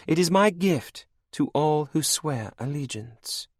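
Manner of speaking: high, cold and mocking; villainess queen. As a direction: purr it over your captive like a cruel queen. Anne offers the blood.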